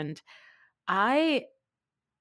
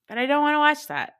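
exasperated outburst; 8 semitones higher, 7 dB louder, faster than usual. Real, not acted. Exasperated outburst about boring episode.

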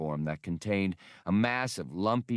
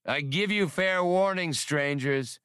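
called warning; 9 semitones higher than usual. A narrator's reading. Shore gang chief calls threat.

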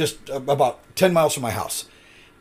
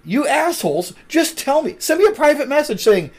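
much higher, fed-up mock rant at the staff; exasperated outburst; 10 semitones higher than usual. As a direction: vent your frustration, pitch climbing. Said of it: "And I'm like".

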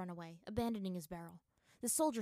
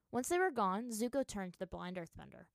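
ordinary delivery

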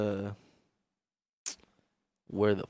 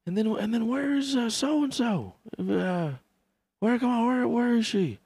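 high, halting old-man Biden impression; mock Biden, higher and searching; imitating Biden; 11 semitones higher than usual. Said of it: "Biden was doing that speech".

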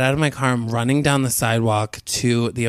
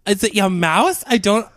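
high, loud bafflement; exasperated high-pitched questioning; incredulous; 9 semitones higher, 4 dB louder than usual. Real, not acted. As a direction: rant in baffled disbelief, pitched way up. Teller shares the disbelief.

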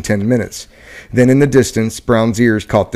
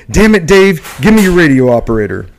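frustrated, raised barking order; angry commander; 5 semitones higher than usual. Acted. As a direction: snap at him, exasperated.

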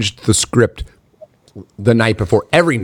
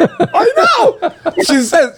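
laughing; much slower than usual. Real, not acted.

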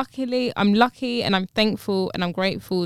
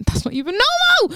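much higher, indignant exclamation; outraged disbelief, pitched way up; indignant outburst; 12 semitones higher than usual. Real, not acted. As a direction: protest it in a high-pitched burst of disbelief. Indignant at men's behaviour.

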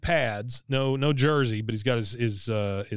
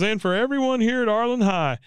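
high Ricky voice; 9 semitones higher than usual. Retold or imitated. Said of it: "He goes".

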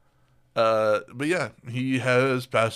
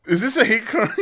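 laughing; 13 semitones higher, 4 dB louder than usual. Real, not acted. Laughing through joke.

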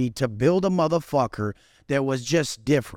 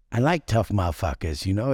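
low mock Tyson voice, a touch of lisp-free gruff; doing a lower-pitched Tyson impression; imitating Mike Tyson; 9 semitones lower than usual. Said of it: "He's like, he's like".

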